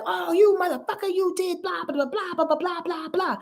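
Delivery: high, mock-furious rant; a hammed-up high rant; mock angry accusation. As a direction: imitate someone ranting at you. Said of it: "People can be like".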